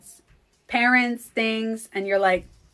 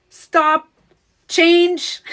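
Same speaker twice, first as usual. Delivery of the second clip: loud, high "Stop." then "Change."; firm command; 7 semitones higher, 8 dB louder than usual. Shown as a demonstration. She shows viewers how to interrupt a thought, loudly. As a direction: snap yourself out of it. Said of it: "demonstrating her mantra".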